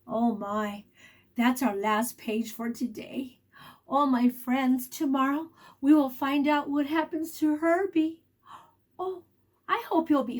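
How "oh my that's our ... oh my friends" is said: ordinary delivery, nothing marked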